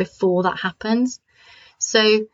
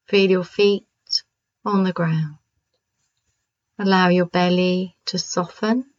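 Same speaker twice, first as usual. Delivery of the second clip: calm grounding exercise; 2 semitones lower than usual. Acted. Leads the exercise again.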